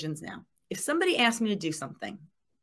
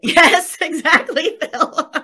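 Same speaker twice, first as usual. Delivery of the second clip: bursting into real laughter, high and loud; laughing; 9 semitones higher, 12 dB louder, much slower than usual. Real, not acted. Laughing loudly as she reads Phil's comment aloud. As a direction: laugh it out while answering Phil.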